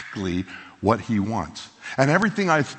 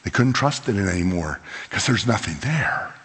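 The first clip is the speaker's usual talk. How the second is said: ordinary talk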